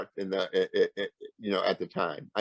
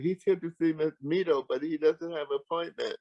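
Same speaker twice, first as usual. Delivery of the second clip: higher, lightly voiced young receptionist; imitating the receptionist; 7 semitones higher than usual. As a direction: play the prim young receptionist explaining. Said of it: "the young girl said".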